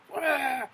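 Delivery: high, loud mock dragon cry; dragon shriek. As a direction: yelp like a speared dragon. Dragon hit by a bolt.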